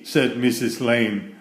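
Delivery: lively read-aloud for children, voicing each character; story narration. A narrator's reading. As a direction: read it like a bedtime picture book. Reads picture book aloud.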